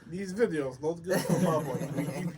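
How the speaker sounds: mock Russian accent, broken English; playful fake Russian. Joking about Russian censorship.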